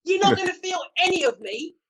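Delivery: mock-outraged, putting your foot down; indignant refusal. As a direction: refuse firmly, voice pitched right up. Acted firm refusal to directors.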